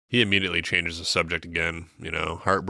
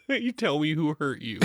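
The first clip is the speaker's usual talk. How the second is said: higher, teasing comfort with a laugh; mock comforting; 8 semitones higher than usual. Co-host pretending to hug the bitter heroine, mock tender.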